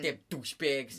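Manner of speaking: put-on Boston cursing; Boston accent. Bartender griping about customers, in a Boston accent. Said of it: "in a Boston accent".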